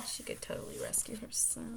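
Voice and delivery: low whispered side comment; whispered aside. Real, not acted. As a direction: mutter it under your breath. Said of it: "snarky aside whispered".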